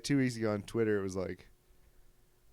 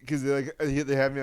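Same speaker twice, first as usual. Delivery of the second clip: ordinary talk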